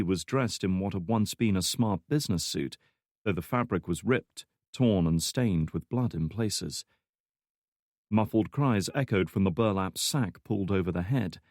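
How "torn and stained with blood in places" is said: ordinary delivery, nothing marked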